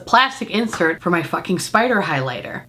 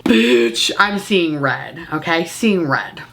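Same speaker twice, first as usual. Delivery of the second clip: slow deadpan; 6 semitones lower, much slower than usual. Acted. Ranting about a bad purchase.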